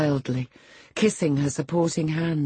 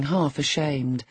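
usual speaking voice

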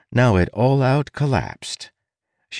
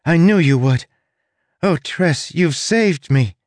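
high, joyful gushing; excited relief; 9 semitones higher, 4 dB louder than usual. Acted. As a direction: gush with grateful delight. Charlie rushes to Tress.

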